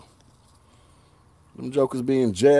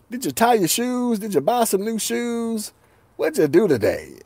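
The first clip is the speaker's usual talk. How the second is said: higher-pitched mock small talk; mock exasperated questions; 8 semitones higher than usual. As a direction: pitch it up, prodding for any other topic. Imagined questions to cousin.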